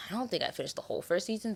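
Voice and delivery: fast unvoiced confession; whispered aside. Real, not acted. Admitting to viewers she didn't finish, whispered. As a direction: whisper it quickly, half-admitting. Aside about unfinished show.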